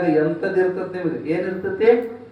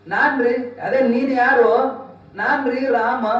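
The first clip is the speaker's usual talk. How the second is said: high eager disciple; 8 semitones higher than usual. Acted. Rama answering guru.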